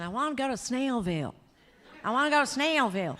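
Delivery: a Southern friend from Alabama pleading to get to Snellville; thick Southern accent. Retold or imitated. Friend from Alabama asks phone for directions, loud insistent Southern accent. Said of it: "he kept saying".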